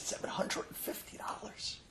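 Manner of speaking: hushed, breathless disbelief at the bill; stunned whisper. Acted. Patient stunned by the receptionist's bill, stunned whisper.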